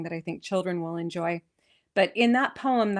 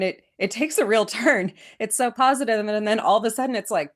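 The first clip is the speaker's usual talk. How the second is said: ordinary delivery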